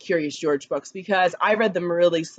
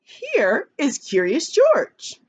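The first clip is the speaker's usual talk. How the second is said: higher, lively voice, not like a robot; expressive read; 5 semitones higher, slower than usual. Shown as a demonstration. Teacher showing kids how her reading voice changes, livelier and higher. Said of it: "See how my voice changes?".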